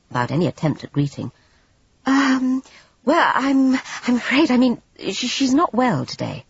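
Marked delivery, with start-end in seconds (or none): 2.0-6.4 put-on high, halting, embarrassed young woman; higher voiced Jane, nervous and apologetic; flustered Jane. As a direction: stammer it out, caught out and covering.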